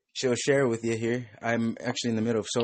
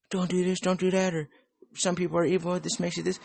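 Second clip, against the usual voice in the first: high, whiny preachy voice; mocking preachy people; 10 semitones higher than usual. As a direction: parody a nagging moralizer. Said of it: "People say".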